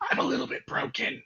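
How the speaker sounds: high, unvoiced broken squeak; cracked high voice. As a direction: squeak it out, half unhinged. Player describes broken mind.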